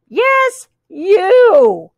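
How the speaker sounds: high, drawn-out pointed words; accusing animal chorus. A narrator's reading. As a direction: come down hard in a high character voice. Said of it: "all the animals in their mixed-up voices".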